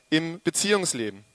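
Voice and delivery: ordinary talk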